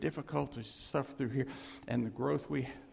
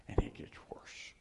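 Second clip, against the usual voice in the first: hushed, breathless punchline; whispered aside; 5 dB quieter than usual. Real, not acted. Preacher whispering the punchline to the congregation. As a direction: drop it to a whisper.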